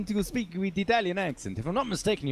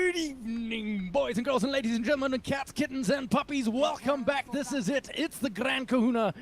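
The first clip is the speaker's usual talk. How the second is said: high, fast showman announcer voice; Storm Spirit; 7 semitones higher than usual. Acted. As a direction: rattle it off like a flamboyant hero. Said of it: "It's so Storm Spirit".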